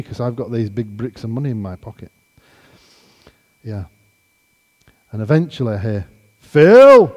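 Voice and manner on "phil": huge high holler as a Texan; imitated Texan shout of greeting; larger-than-life Texan. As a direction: boom out the name like a big Texan. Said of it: "larger than life Texan".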